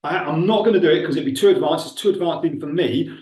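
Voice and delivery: normal talking voice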